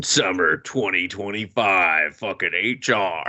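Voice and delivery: mock trailer announcer. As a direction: boom it like an ad. Fake trailer sign-off.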